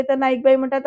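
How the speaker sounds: usual speaking voice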